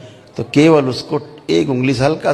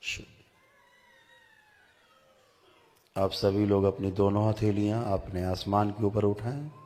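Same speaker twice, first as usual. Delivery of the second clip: very soft, hushed meditation guidance; guided meditation; 15 dB quieter than usual. Real, not acted. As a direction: guide them gently inward, barely raising your voice. (धीमी आवाज़ में ध्यान का निर्देश)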